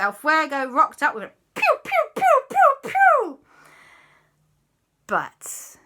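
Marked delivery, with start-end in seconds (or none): 1.5-3.4 loud, very high shots; laser zaps. Acted. El Fuego joins the attack, squeaky laser noises.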